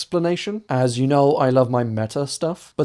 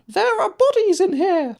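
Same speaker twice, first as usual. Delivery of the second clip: squeaky falsetto, far above the usual pitch; much higher voice. Shown as a demonstration. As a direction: jump way up into a thin high voice, unlike your own. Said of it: "his voice sounded much higher than usual and most unlike his own".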